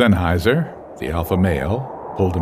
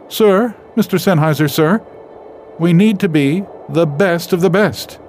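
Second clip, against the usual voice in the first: much higher, louder small cub's voice; eager little cub; 13 semitones higher, 7 dB louder than usual. Acted. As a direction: salute the leader like an eager child. Eager little cub salutes the leader.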